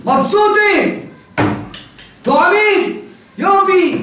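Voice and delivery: high, gleeful bursts; delighted exclamations. A narrator's reading. Preacher cheers the thieves' success, gleeful and high. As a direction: cheer each word like a little victory.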